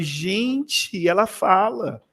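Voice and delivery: high, hushed retold astonishment; moved amazement. Teacher hears the mute pupil finally speak, moved amazement. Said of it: "Aí eu me emocionei".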